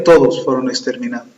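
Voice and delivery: ordinary talk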